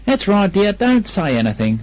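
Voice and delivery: a hint of a coaxing mother; lightly voiced Kanga. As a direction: tease him gently. Kanga bathing Piglet.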